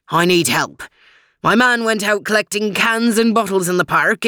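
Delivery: put-on a lightly Scottish, pleading old terrier; Scottish burr, worried and asking for help; a touch of Scottish worry; Scottish dog. Jock voiced, Scottish terms.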